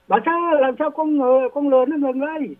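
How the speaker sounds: high, anxious calling out; panicked question. Retold or imitated. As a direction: call out in a rush of panic. Tên cướp hỏi gấp bà sơ. (tên cướp hoảng hốt gọi bà sơ giọng cao)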